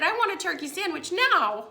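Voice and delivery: high-pitched imitation of a hormonal fit; mock tantrum. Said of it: "throwing a fit".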